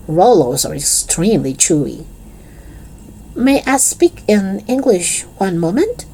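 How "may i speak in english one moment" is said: put-on higher, hesitant English with a French accent; tentative French accent. Pupil posing as French asks teacher, tentative French accent. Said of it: "in a tentative French accent".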